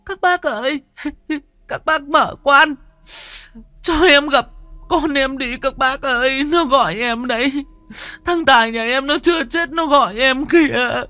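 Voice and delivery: very high, hysterical bereaved mother; wailing plea. A narrator's reading. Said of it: "Bà gào lên".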